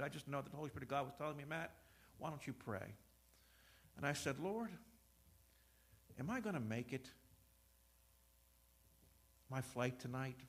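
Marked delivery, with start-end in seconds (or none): 1.5-2.9 very soft and low, voicing God; a low, hushed nudge from within; hushed inner prompting. Retells the Spirit's inner prompting. 4.4-10.4 low, hushed question to the Lord; quiet prayer. Retold or imitated. Retells his quiet prayer on train.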